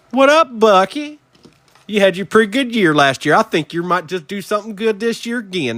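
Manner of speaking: high, fast put-on chatter; playful greeting voice. Host greeting Bucky Irving's card, playful high voice. Mock chat to a player's card.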